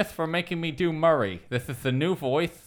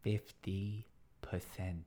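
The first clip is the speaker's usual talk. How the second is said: slow, low, lisped repeat; lisping host, slow and low; Murray's lisp; 8 semitones lower, 16 dB quieter, slower than usual. Acted. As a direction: drag it out, mock stunned. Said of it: "It's really hard to do a lisp for this long".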